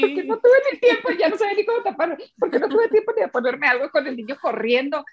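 laughing high and embarrassed through the words. Real, not acted. Embarrassed about being in bikini.

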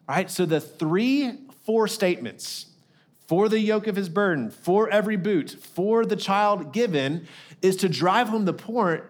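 ordinary talk

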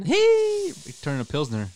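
high, loud cheer. Real, not acted. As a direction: whoop for the team. Cheering a team name.

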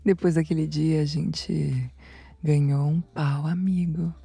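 low, soft afterglow narration; soft, low intimate murmur; intimate storytelling. Acted. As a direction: tell it hushed and spent. Spent, hushed storytelling after climax.

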